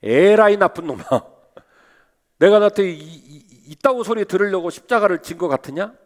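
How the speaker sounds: mock furious Lord scolding. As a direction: scold Peter half-laughing. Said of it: "저 같으면".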